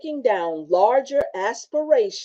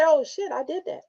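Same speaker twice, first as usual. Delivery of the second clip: pleased surprise; 8 semitones higher than usual. Shown as a demonstration. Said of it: "I need to say".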